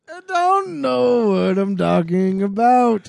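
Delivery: high, loud, slow comedian impression; Bill Cosby. Co-host carries on the bit, Bill Cosby impression. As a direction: draw every word out in character. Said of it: "There he goes".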